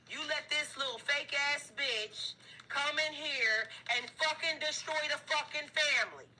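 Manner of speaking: high, loud, slowed furious rant; high furious voice raging at the fumbler; angry family. Acted. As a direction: scold them like the betrayed family. Voicing the upset spirit family.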